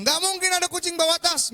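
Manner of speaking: high, loud incredulous shout; angry teacher. Retold or imitated. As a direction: yell back as the exasperated teacher. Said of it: "Guru saya bilang". (guru membantah sambil berteriak)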